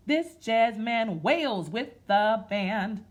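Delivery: slow, sing-song rhythmic reading; read-aloud rhyme. Acted. Teacher reading a jazz picture book to kids, in a slow sing-song. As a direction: chant it like a nursery rhyme, slow.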